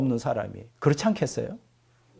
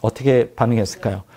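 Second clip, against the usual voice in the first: usual speaking voice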